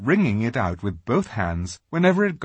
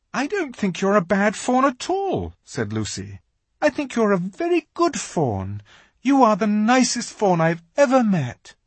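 put-on higher, comforting girl's voice; kind girl; 11 semitones higher than usual. A narrator's reading. Lucy comforting the fawn, earnest and kind. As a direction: reassure him earnestly.

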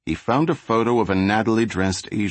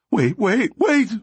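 high, louder desperate begging; pleading; 3 dB louder, slower than usual. Acted. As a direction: beg for your life, voice climbing. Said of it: "Flacco pleaded".

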